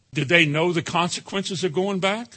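ordinary talk, nothing marked

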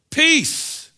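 peace cried out high and loud; emphatic peace. Shown as a demonstration. Preacher showing the congregation Hebrew emphasis, ringing peace. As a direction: lift the second peace high and ring it out. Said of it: "It's twice for emphasis".

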